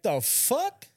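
high, disbelieving "the fuck?"; catching a stranger in his own car; shocked outburst. Retold or imitated. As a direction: blurt it out in total disbelief. Sees stolen car being driven.

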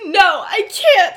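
hammed-up yell of refusal; half-laughing loud no; mock shouted refusal. Heated argument between friends.

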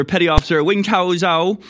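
a weaker try at the mock Chinese accent on the name. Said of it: "That one wasn't as good".

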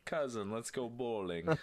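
quick Italian accent impression; a game character's Italian accent. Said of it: "Nice, nice Italian accent".

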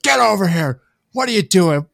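loud, high, scolding grandfather; angry grandfather. Retold or imitated. Grandfather calls the grandson over after the goal dive, loud and high scolding. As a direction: yell at the kid to get over here. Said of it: "My grandfather's like".

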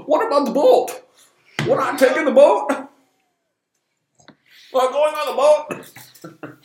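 high, loud mock-frantic film voice; hammed-up high shouting; shouted movie quote. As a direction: yell it like a frantic film character.

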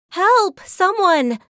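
high, loud yowl of Help. Acted. Cat yowling for help from the next room, loud and high. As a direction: cry out in alarm like a cat in trouble. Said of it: "Cecily heard the yowl".

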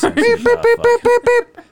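very high, loud beeping tones; censor beeps. Pretend. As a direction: beep like a censor bleep. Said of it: "We're gonna sound like Morse code".

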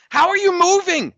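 high-pitched incredulous demand; exasperated disbelief. Acted. Mock scolding an absent player.

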